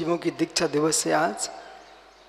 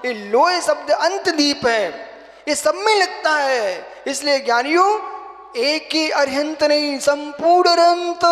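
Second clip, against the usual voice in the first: high, loud, measured proclamation; full-force preaching; 9 semitones higher, 7 dB louder than usual. Real, not acted. Monk explains the salutation to disciples, preaching at full force. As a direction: hammer out each parallel phrase at full voice.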